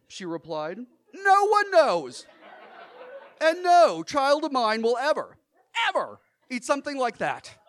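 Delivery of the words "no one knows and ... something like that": scolding. Retold or imitated. Mother scolds child in store.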